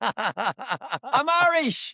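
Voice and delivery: very slow, laughing through each word; slow laughing correction. Real, not acted. Guest correcting the host's mix-up again, slow and laughing. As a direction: stretch it out and laugh as you correct him. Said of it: "Let me".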